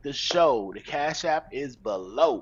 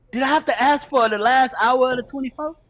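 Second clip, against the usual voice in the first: high, louder challenge in disbelief; indignant retort; 8 semitones higher, 5 dB louder than usual. Acted. Questioning a woman who left out the last hour, indignant. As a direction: throw the question back, pitched up. Acted retort to a partner's omission.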